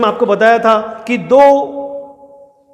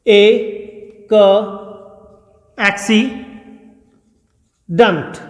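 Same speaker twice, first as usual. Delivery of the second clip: Accident drawn out very slowly; slow teacher's demo of accident; much slower than usual. Shown as a demonstration. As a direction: stretch accident for learners. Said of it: "देखिए कैसे".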